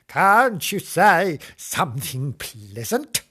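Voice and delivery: put-on drawn-out old lady question; old woman voice. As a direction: complain slowly as an old woman. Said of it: "this is my old woman voice".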